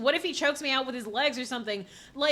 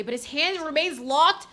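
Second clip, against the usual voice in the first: ordinary delivery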